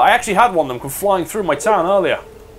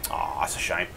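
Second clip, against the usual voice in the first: ordinary talk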